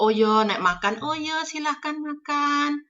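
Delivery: tinggi, pelan, menawari makan dengan sopan; basa-basi Jawa. Retold or imitated. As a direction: lembut mempersilakan ala orang Jawa. (menawari makan ala Jawa, pelan dan tinggi)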